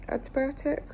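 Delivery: doubtful questioning rise on the word; unsure. Real, not acted. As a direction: say it like you're not sure of the name. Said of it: "I don't feel like it's called antibiotic cream".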